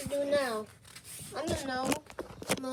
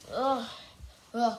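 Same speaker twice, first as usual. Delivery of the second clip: normal talking voice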